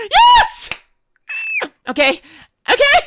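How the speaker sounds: gasp, then a very high, loud yes; excited shriek. Real, not acted. Streamer hears yes to a date, shrieks happily. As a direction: squeal with disbelieving joy. Friend accepts a date offer.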